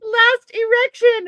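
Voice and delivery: high, slow giggling "Last erection"; laughing repeat. Real, not acted. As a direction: repeat it while cracking up.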